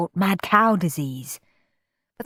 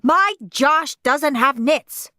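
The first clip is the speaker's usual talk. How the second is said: higher, slow indignant shout; shouting mother; 8 semitones higher, 4 dB louder, slower than usual. A narrator's reading. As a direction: shout it in outrage. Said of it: "shouted his mother".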